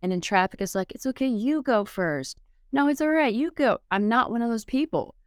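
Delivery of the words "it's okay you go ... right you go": high, sweet and yielding, waving others ahead; mock-gentle high voice of a patient driver; soft-spoken kind driver. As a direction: play the overly polite driver who lets everyone go first. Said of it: "soft-spoken and kind and patient and gentle".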